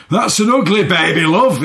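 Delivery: high, loud shouted Yorkshire; broad Yorkshire accent. Retold or imitated. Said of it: "in a really broad Yorkshire accent".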